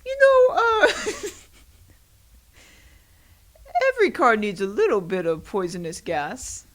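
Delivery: high squeal breaking into laughter; cracking up mid-sentence; laughing through it. Retold or imitated. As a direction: giggle out the excuse. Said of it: "and I was like".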